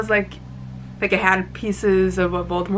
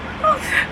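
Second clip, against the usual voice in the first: sharp excited gasp. Real, not acted. Excited reaction to the story.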